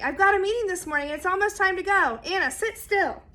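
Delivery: hurried. A narrator's reading. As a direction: hurry through it while packing up to leave. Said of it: "his mom was in a hurry".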